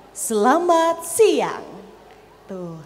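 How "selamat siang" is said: "selamat siang" slow and high, falling on "siang"; stretched intonation. Shown as a demonstration. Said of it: "lekukan-lekukan sendiri". ("selamat siang" diulur pelan, nada tinggi lalu turun)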